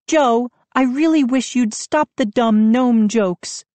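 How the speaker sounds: high, sharp rebuke; mother sharply scolding her son. A narrator's reading. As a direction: cut him off sharply. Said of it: "Mom said sharply".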